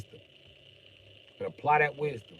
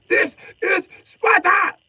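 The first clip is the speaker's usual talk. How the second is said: high, slow, loud battle cry; Sparta shout; 11 dB louder, much slower than usual. Pretend. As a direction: roar it like a movie warrior. Movie quote shouted.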